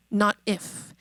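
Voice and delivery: normal talking voice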